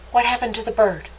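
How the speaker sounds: ordinary delivery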